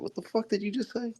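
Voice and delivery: hammed-up confusion; mock baffled reaction. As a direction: squeak it in disbelief. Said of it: "they're just like".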